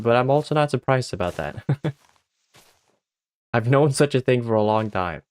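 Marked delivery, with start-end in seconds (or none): none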